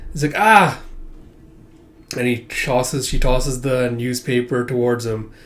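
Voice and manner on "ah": high, loud yelp; startled cry. Acted. Man about to get a newspaper tossed at him, startled cry. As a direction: cry out suddenly in mock alarm.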